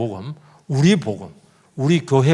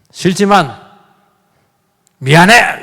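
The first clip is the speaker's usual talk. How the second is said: grudging shouted apology; 3 semitones higher, 8 dB louder, slower than usual. Pretend. Forced apology in a quarrel.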